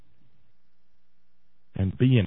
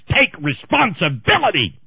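shouted demand; 6 semitones higher, 5 dB louder than usual. Real, not acted. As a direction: Take responsibility! yelled loud and higher. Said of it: "you're screaming again".

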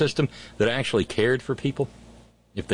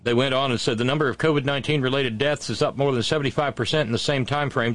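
ordinary delivery

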